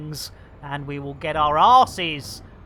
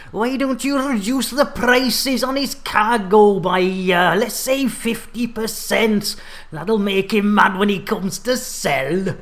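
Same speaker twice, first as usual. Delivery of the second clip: louder, hammed-up villain plotting the price cut; scheming HQ boss; 3 semitones higher, 8 dB louder than usual. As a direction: play the gleeful schemer at headquarters. Narrates scheming game HQ.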